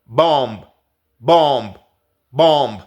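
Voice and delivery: "bomb" with a strong lip pop on the b; exaggerated b. Shown as a demonstration. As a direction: overdo the b in "bomb". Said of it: "lo tienes que exagerar un poquito".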